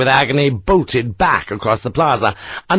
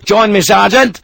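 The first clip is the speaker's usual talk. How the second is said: calling out over battle noise, louder and higher; shout; 9 semitones higher, 7 dB louder than usual. Acted. Sharp calls to his sergeant over the fighting, shouting. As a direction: bellow it across the din. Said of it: "Sharp shouted at Harper".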